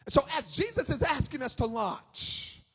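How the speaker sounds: ordinary delivery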